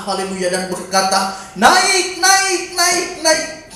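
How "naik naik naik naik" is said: put-on high, repeated call to children in the sea; imitated mother's shout. Mother with rattan calls swimming children from the beach, shouting. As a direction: act out mum yelling them out of the water. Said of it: "dia berteriak di tepi pantai sana".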